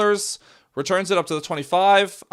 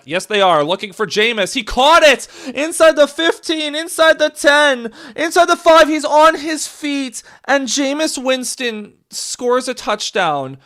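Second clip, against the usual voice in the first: yelling higher and louder as he runs in, slowing at the end; excited touchdown call; 11 semitones higher, 8 dB louder than usual. Real, not acted. Fan calling the touchdown live, screaming with excitement. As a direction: call the score like you can't believe it.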